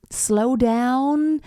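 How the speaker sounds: drawn-out slowed delivery; demonstrating a phrase easing off; slowing down. Shown as a demonstration. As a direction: stretch the words to show slowing. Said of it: "does it want to kind of".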